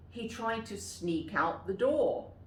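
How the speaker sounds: plain reading voice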